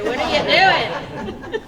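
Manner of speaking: loud shouted mock question, rising; Ryder impression. Host imitating Ryder heckling the jumper, loud shout. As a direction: call it out as a mock challenge. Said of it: "needed Ryder there to say".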